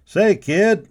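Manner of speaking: loud, high call. A narrator's reading. Cowboy calling to the boy, loud and high. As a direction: hail the boy from across the yard. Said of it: "a cowboy called to him".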